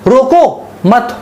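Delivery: "रोको मत" drawn out slowly as one unit; "रोको मत" held apart from what follows; comma pause demo. Shown as a demonstration. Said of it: "मैंने comma लगा दिया".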